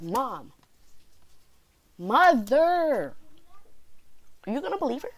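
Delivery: high-pitched, exaggerated plea to a parent; mock incredulous appeal. Student protesting to mom over agenda note, mock incredulous. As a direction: appeal in high, hammed-up disbelief.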